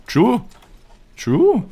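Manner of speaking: squeaky high "True" rising. Acted. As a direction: chirp it way up high. Mock agreeing in a squeak.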